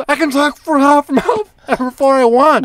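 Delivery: high, slow holler back; imitated indignant mom. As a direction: shout a stubborn retort from far off. Mom retorts from far away.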